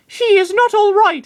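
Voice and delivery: earnest and squeaky, loyal to his trainer; high Lucario voice. Acted.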